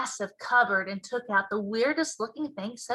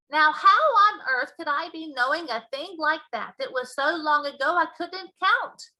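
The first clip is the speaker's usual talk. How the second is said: BFG giant voice; 7 semitones higher, 7 dB louder than usual. Acted. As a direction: boom out the giant's mangled grammar.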